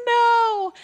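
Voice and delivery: high, loud mock cry of a little boy; imitating child's wail. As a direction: wail it like an upset toddler. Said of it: "started crying hysterically".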